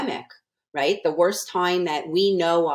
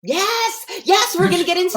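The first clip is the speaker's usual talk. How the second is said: excited; 7 dB louder than usual. Real, not acted. Laughing reply to the teasing.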